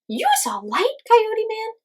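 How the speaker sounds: high, loud disbelieving bird; incredulous. A narrator's reading. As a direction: ask as if it can't be true. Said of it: "The little voice was incredulous".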